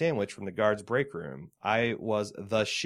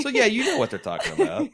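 laughing; 13 semitones higher, 6 dB louder than usual. Real, not acted. Laughing reply to joke.

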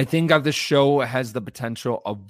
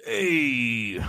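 put-on very old man. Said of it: "He's, like, a very old man".

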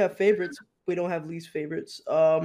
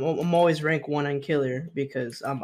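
ordinary talk, nothing marked

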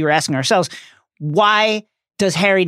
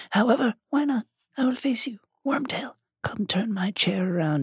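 soft, higher cold whisper; icy cold; 5 semitones higher, 8 dB quieter than usual. Acted. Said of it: "the cold voice".

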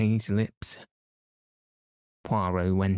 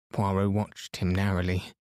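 ordinary delivery, nothing marked

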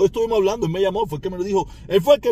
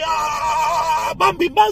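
mock heart attack cry; 7 semitones higher, 4 dB louder, much slower than usual. Mimics a heart attack live.

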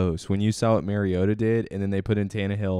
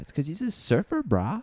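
surfer dude voice; 9 semitones higher than usual. Pretend. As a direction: play it like a stoned surfer. Surfer dude impression of Mariota.

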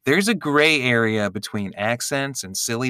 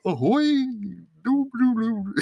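higher, goofy singsong clown voice; silly clown voice; 10 semitones higher than usual. Acted. Answering what a clown sounds like, silly clown voice. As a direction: toot it out like a circus clown. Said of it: "What does a clown talk like?".

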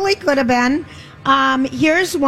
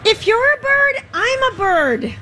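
high, slow, wobbly line reading; high-pitched bad delivery; 11 semitones higher, slower than usual. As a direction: squeak out the line, badly acted. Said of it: "That's a really bad delivery".

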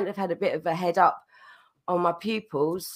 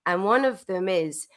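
ordinary delivery

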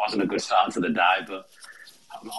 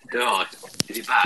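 hollow, echoey call audio; echoing tunnel; 3 semitones higher, 7 dB louder than usual. Real, not acted. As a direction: sound like you're in a tunnel. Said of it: "Sounds like he's in a fucking tunnel".

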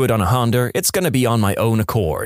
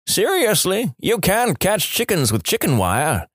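mock yelling back; 8 semitones higher than usual. Old man doubts the kid's plan, incredulous mock yell. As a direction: scoff at the kid in a raised pitch. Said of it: "he yells back".

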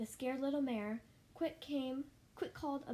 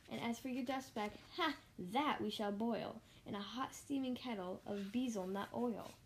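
plain reading voice

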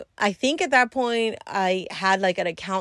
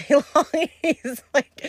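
laughing while retelling, a bit higher and louder; 8 semitones higher, 5 dB louder than usual. Real, not acted. Telling viewers about the waiting client, laughing. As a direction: laugh through the words.

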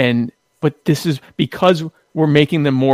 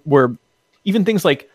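ordinary talk

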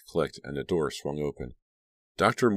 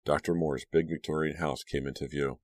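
ordinary delivery

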